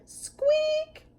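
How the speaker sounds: very high squeak; squeaky brake noise. Acted. Bus brakes sound.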